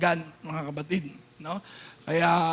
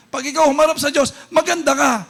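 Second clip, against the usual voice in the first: rousing shout; 5 semitones higher, 7 dB louder, faster than usual. Acted. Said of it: "same line repeated with variation".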